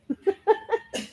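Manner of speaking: light, high giggle at her own slip; real laugh at herself. Real, not acted. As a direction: laugh it off, a little embarrassed.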